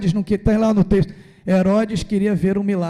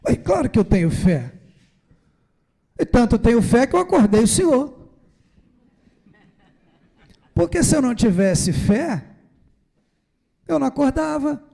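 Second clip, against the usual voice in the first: protesting disciple; 6 semitones higher, 4 dB louder than usual. Retold or imitated. Disciple argues with Jesus.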